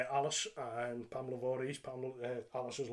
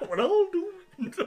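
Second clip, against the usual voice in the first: slow laughing doom cry; Captain Manningham impression; 8 dB louder, much slower than usual. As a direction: wail it like a panicked old captain. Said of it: "Sounds like Captain Manningham".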